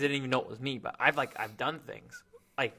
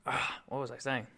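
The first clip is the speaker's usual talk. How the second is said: ordinary talk, nothing marked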